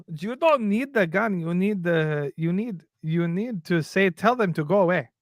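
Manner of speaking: slow, put-on African accent. Voicing Europeans who say guns aren't needed, in African accent. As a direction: do an impromptu African accent, drawn out. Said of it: "why I did an African accent".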